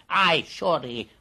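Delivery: ordinary delivery, nothing marked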